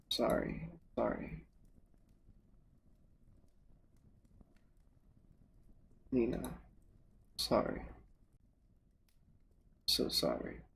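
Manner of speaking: whispered through silent sobs; sobbing whisper. Acted. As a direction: fade into a tearful whisper. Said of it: "her words hide behind her silent sobbing".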